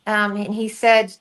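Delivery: plain reading voice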